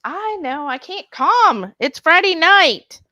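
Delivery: giddy, slow and loud; can't contain the Friday night buzz; excited. Real, not acted. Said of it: "calm down".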